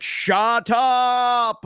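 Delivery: Arnold impression. Fed up with Rick and Morty talk, quoting Arnold in mock. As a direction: stretch out a mock Arnold "shut up". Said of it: "I was literally like Arnold in, uh, Kindergarten Cop".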